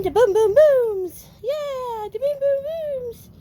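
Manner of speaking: high excited pet-talk praise; puppy talk. Real, not acted. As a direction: coo the fireworks into a game for the pups. Praising puppies while fireworks boom.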